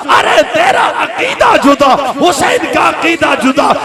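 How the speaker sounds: shouted, hammering parallel lines; fiery sermon climax. Acted. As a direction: pound each contrast at the crowd. (गरजता हुआ प्रवचन)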